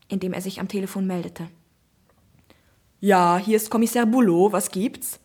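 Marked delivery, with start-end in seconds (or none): 3.0-5.2 a loud, gruff inspector answering; gruff policeman. Acted. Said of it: "Der Polizist".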